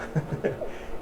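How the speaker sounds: airy unvoiced chuckle; breathy laugh at own joke. Real, not acted. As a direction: laugh silently through the breath.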